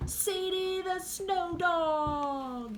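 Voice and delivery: high, drawn-out showman's call; slow ringmaster-style yell, falling at the end; yelled announcement. A narrator's reading. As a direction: announce it grandly, stretching every word. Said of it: "yelled Martin".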